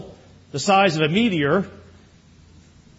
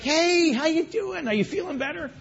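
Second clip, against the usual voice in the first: high, soft sing-out greeting; retold high-voiced, concerned hello; friendly check-in; 11 semitones higher, 5 dB quieter than usual. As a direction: greet him lightly, pitched way up. Retells cheerily checking on absent member.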